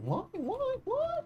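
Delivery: high, squeaky repeated why; mock whine. Gamer squeaking at the game, mock whining. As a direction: whine up at the screen like a cartoon.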